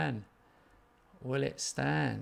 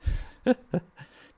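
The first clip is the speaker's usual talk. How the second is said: laughing; 8 semitones higher, 9 dB louder than usual. Real, not acted. After chanting.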